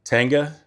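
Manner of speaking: "Tanga" with stress on TAN and a rising finish; emphasis on tanga. Shown as a demonstration. Vlogger stressing the dish name for viewers. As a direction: lean into the first syllable of "Tanga". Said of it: "I wanna put some emphasis on that".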